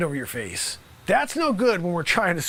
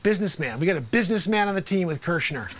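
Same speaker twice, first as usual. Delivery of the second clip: ordinary delivery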